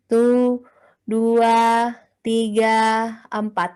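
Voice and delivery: slow, measured count for a breathing exercise; slow guided counting. Shown as a demonstration. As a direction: count calmly as they breathe.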